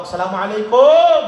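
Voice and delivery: loud greeting called through a door; calling out. Retold or imitated. Visitor knocking at her house, loud called-out greeting. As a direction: call it out to someone inside.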